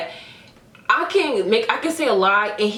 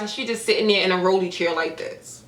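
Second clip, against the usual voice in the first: normal talking voice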